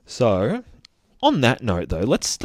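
ordinary talk, nothing marked

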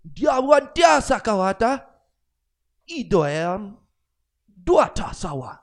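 loud, high, made-up African chanting; hammed-up African-language parody, high and forceful; mock African native speech. Shown as a demonstration. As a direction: declaim gibberish in a fake African accent. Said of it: "my African, um, na-, like, native speaking".

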